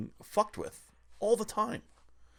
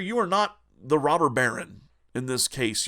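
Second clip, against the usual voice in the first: ordinary talk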